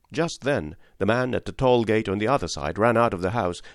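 ordinary delivery, nothing marked

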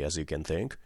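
usual speaking voice

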